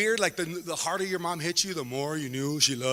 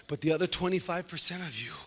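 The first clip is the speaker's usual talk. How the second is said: usual speaking voice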